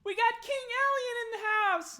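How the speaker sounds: very high, very loud squeal of recognition; squealing very loud at spotting the celebrity; excited shout. Acted. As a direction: shriek it like a starstruck fan. Darnsky announces the king.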